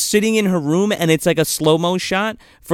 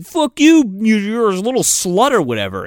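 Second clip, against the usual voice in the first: mock angry comeback; 4 semitones higher than usual. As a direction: fire back like the insulted boyfriend. Boyfriend curses back in retold quarrel.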